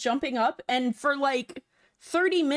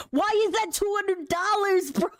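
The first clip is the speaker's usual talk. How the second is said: shocked yell into the mic; 9 semitones higher than usual. Real, not acted. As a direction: yell it at the price in disbelief. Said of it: "I'm sorry for yelling into the mic".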